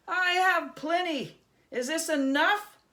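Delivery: high, loud, eager goblin squeal; greedy goblin. Acted. Goblin eagerly offers his gold to Herschel, loud high squeal. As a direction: shove the gold across, squealing.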